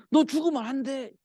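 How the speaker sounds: high-pitched urgent plea; acted pleading. Preacher plays the king begging Daniel to live, pleading.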